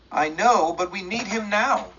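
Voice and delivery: high, loud wailing lament; wailed. A narrator's reading. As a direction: cry out for her absent husband. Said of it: "she wailed".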